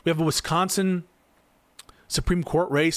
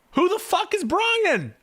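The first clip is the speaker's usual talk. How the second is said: much higher, slow disbelieving question; incredulous outburst; slower than usual. Real, not acted. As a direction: squeal it out in total disbelief. Exasperated at article's source.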